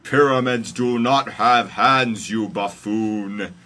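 loud, slow, high telling-off; hammed-up exasperated deity; comic scolding. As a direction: scold slowly at full voice. God scolds Moses in story.

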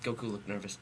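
ordinary talk

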